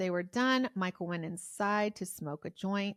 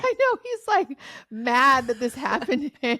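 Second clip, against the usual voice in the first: laughing loudly, much higher; 7 semitones higher, 13 dB louder than usual. Real, not acted. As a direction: burst out laughing while you talk. Laughing at the caller's complaint.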